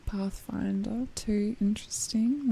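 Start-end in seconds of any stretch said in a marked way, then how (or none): none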